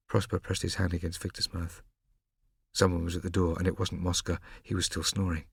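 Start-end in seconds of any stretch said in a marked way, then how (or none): none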